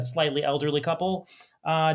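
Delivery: ordinary delivery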